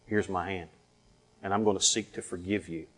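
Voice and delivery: low, hushed resolve; solemn forgiving. Retold or imitated. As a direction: offer forgiveness softly and gravely. Quoting a woman choosing to forgive.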